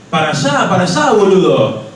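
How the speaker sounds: impatient Argentine waiter. Retold or imitated. Waiter losing patience with a lost tourist, loud Argentine accent. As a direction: repeat it at the clueless foreigner, exasperated, in a Buenos Aires Spanish accent. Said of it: "And he just keeps on repeating".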